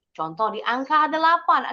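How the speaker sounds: ordinary delivery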